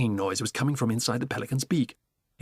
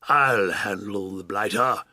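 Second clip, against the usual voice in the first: slow, lower blustering boast; blustering Duke; 3 semitones lower, slower than usual. Acted. Duke boasting to the giraffe, slow bluster. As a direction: swagger like a brave old duke.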